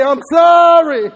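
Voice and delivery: loud, slow, high I'm sorry; shouted apology. Shown as a demonstration. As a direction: shout it out to the whole room.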